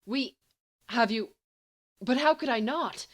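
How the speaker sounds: rising panicked plea; distressed. Acted. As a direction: voice climbing in distress. Distressed pleading to friend.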